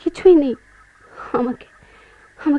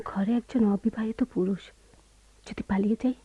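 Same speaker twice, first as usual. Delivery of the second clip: soft, breathy, teasing whisper; wife teasing husband about running off, whispered; 6 dB quieter than usual. Acted. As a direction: hush it like a sly dare.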